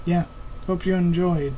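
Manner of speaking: normal talking voice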